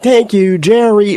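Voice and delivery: high, loud fan call-out; appreciative crowd fan. Acted. Fans in the crowd to a wrestler, appreciative call-out. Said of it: "the fans in the crowd could have been like".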